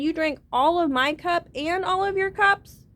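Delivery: high, loud, slow incredulous God; outraged disbelief at the greedy drinker; loud astonished God demanding an answer; shocked disbelief. Acted. God shocked at drained cups.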